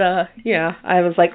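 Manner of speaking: normal talking voice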